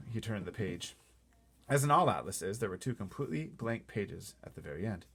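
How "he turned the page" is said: ordinary delivery, nothing marked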